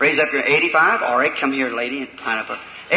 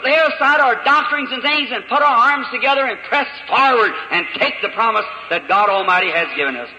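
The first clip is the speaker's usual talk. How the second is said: fervent preaching; 8 semitones higher than usual. Acted. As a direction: press it on them like a revival preacher. Preaches at full force.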